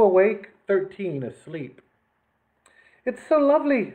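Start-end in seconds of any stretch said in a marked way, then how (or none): 3.0-3.9 dreamy Persian. A narrator's reading. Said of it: "said the Persian as she gazed".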